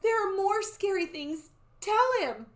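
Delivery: high, loud insistent voice; urgent child. Acted. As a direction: press her to speak as eager Annie. Annie urgently prompts the soothsayer.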